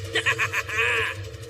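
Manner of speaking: big battlefield laugh; exultant laugh. Acted. Warrior delighted by a lad like himself, exultant laugh.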